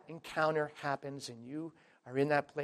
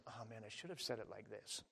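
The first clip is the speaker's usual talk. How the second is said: mock regret; 4 semitones lower, 18 dB quieter than usual. Mock self-reproach after ministering.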